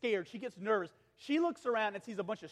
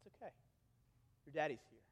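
put-on soft, low hush to a scared toddler; comforting daddy; 7 semitones lower, 12 dB quieter, much faster than usual. Father soothing his crying daughter, soft and low. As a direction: settle her down gently. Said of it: "and I calm her down".

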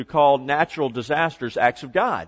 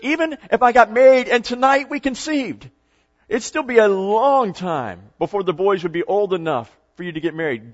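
exasperated argument; 4 semitones higher, 4 dB louder than usual. Acted. Acted Naomi argues it is hopeless.

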